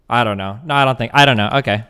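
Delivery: normal talking voice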